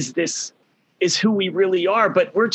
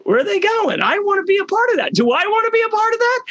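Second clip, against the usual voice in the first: high, fast, eager voice of curious people; high-pitched and quick, an eager crowd wondering aloud; curious onlookers. Retold or imitated. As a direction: pitch it way up like excited gawkers asking each other. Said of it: "they're saying".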